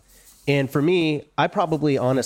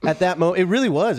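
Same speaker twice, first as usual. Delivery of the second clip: laughing; 8 semitones higher, 3 dB louder than usual. Real, not acted.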